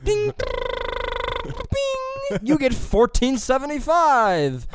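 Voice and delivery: high, slow chiming robot voice; drive-thru machine voice. Retold or imitated. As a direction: play the pay screen announcing a random price. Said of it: "she goes like this".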